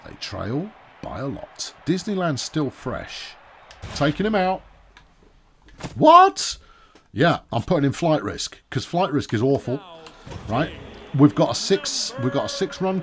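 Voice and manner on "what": sudden high, loud disbelieving what; shocked what. Real, not acted. Commentator reacting to the pitcher being pulled, high shocked yelp.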